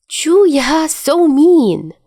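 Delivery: high, loud mock-wounded cry; exclaiming. A narrator's reading. Dazai to Chuya over his tricks failing, high loud playful exclaim. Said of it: "Dazai exclaims".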